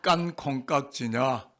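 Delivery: slow, measured phrase to repeat; slow model reading. Shown as a demonstration. Preacher leading congregation in a tongue-twister, slow model reading. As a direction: say it slowly for others to echo. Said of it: "저를 한번 따라하겠습니다".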